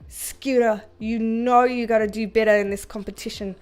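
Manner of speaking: low-pitched mock Australian accent; attempted Australian accent. Telling the chihuahua off like a model judge, Australian accent. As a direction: scold in a hammy Australian accent. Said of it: "Australia is my favorite accent in the world".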